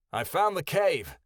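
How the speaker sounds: high, carrying call. A narrator's reading. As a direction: call out the news to the others. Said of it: "Kyoto called".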